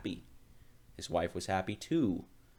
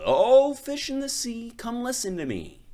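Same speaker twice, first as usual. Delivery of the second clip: high, loud call to the sea; sing-song call across the water; 10 dB louder than usual. Acted. Fisherman calls out over the sea to the fish, high loud call.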